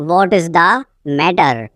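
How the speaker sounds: teacher setting "matter" apart, falling; broken-up repeat. Shown as a demonstration. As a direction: pause before "matter".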